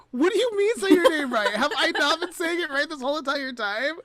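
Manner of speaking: high, loud, startled questioning; stunned to learn she said it wrong; shocked disbelief. Retold or imitated. To her friend, finding out she mispronounced his name, shocked. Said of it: "And I was like".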